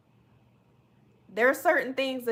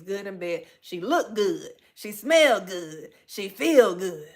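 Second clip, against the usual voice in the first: lightly voiced soul singer, drawn-out punchy phrases; hint of a soul singer, stretching each line; 4 dB louder than usual. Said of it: "in my [name] voice".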